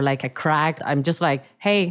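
normal talking voice

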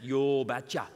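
short reply in a put-on Norwegian accent; thick Norwegian accent. Father-in-law answers a marriage request, thick Norwegian accent. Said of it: "responded in his thick Norwegian accent".

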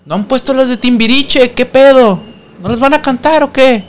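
high, pushy call for the songs; high-pitched party heckle; excited demand. Retold or imitated. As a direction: yell at the band to play them.

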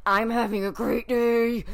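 slow forced chirp; forced cheer. Pretend. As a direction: insist through gritted teeth. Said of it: "That doesn't sound like it".

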